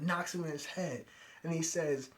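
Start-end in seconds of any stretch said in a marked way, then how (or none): none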